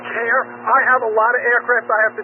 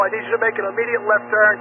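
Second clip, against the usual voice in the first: normal talking voice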